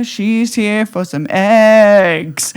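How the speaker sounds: sassy announcement. Pretend. Strutting into the store.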